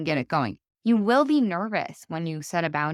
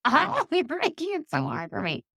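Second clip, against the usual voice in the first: much higher, playful mock protest; mock whine; 12 semitones higher than usual. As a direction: whine back at the teasing husband. Laughing protest to husband.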